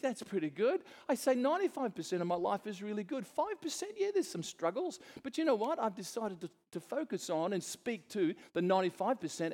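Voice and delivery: high, lively, cheerful claim; upbeat insistence. Retold or imitated. Preacher insisting to a doubting friend, high and bright. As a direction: brim with good news.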